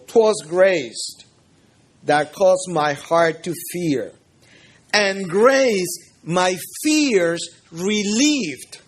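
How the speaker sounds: slow, measured recital in English; hymn verse recitation. Acted. Preacher quoting Amazing Grace to his congregation, slow solemn recitation. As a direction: recite it slowly, phrase by phrase with pauses.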